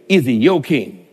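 usual speaking voice

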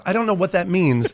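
normal talking voice

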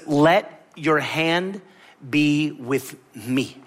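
very slow, drawn-out prayer line; slow, reverent reading. Pretend. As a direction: linger on each word as a prayer. Jabez's prayer words.

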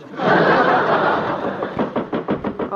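breathy amused laugh; a short laugh. Acted. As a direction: laugh off his crack. Wife laughs at joke.

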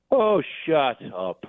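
slow, weighty dismissive shut up; heavy shut up. Retold or imitated. Scottish guy silencing a drunk woman, slow weighty shut up. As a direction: drop it like a bored Scottish local. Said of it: "the heaviest shut up I ever heard".

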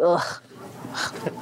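breathy disgusted ugh at food. Retold or imitated. At breakfast, feeling sick at the eggs, disgusted ugh. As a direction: recoil from the smell of eggs.

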